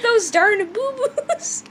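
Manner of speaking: slow, high, half crying with laughter; laughing so hard it sounds like crying. Real, not acted. Second try at reading the name, cracking up. Tries again, still laughing.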